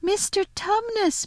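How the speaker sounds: high, drawn-out call of his name; mock bawl in a girl's voice. A narrator's reading. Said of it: "bawled Lucy in his ear".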